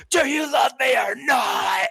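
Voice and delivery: high, loud, slow screamed demand; a jealous girl shrieking at the boy who turned her down; screaming demand. Retold or imitated. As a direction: scream the question at him, slowly and high. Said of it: "starts screaming".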